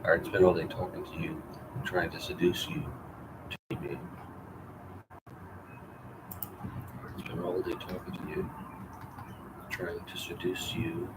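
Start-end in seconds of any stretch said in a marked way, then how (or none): 3.5-11.1 slow, breathy whisper, much softer. Shown as a demonstration. [name] whispering to Grace, trying to seduce her, slow whisper. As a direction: breathe each word out slowly. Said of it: "whispered retake of same line".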